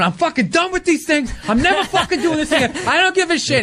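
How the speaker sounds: laughing through a fake tantrum; mock furious rant. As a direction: replay yourself blowing up at the agent on the phone. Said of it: "flipping out, going".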